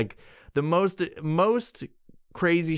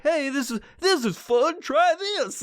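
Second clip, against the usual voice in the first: cheery box voice; 11 semitones higher than usual. Retold or imitated. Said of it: "It's like".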